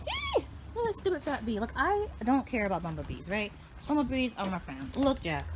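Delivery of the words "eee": squeal. Real, not acted. Startled by a bee.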